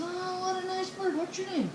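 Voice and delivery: high, soft coo; cooing at a bird. Acted. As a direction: pitch it up sweetly for a pet bird. Burglar coos at a bird.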